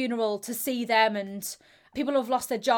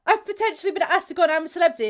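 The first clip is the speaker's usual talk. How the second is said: fast, higher, louder rush of news; excited blurt; 6 semitones higher, 4 dB louder, much faster than usual. Retold or imitated. Telling her boyfriend about the show, fast and excited. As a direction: spill it all out in one breath.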